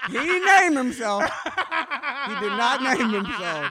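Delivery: slow words broken up by laughing; cracking up while defending him; helpless laughing. Real, not acted. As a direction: protest through real laughter. Trying not to laugh at name.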